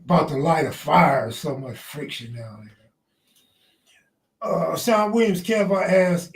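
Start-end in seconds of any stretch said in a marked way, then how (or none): 1.3-4.5 breathy low whisper under the breath. Real, not acted. Muttering to himself while lighting a fire, whispered. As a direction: whisper it as a private aside to the chat.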